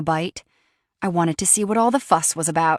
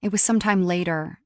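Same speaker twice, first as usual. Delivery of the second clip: plain reading voice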